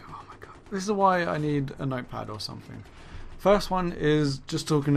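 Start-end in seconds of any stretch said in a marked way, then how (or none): 0.1-0.6 whispered aside. Real, not acted. Muttered aside after forgetting topic.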